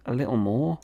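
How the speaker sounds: ordinary delivery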